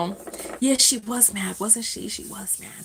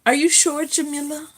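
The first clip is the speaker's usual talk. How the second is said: ordinary delivery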